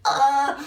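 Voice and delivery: loud, high "ah" yelp; mock panicked scream. Imagining swallowing herself, acted high scream.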